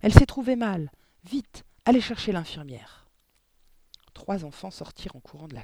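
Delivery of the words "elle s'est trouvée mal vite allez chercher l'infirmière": loud, higher urgent orders; teacher calling for the nurse. A narrator's reading. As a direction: send the children running.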